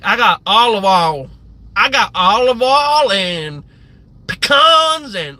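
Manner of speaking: loud, drawn-out Southern drawl; mock a Southern TV cook, loud and stretched; Paula Deen. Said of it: "when she would be like".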